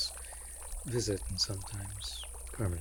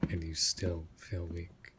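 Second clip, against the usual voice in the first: normal talking voice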